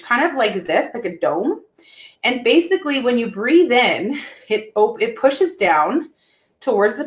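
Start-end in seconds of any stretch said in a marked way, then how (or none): none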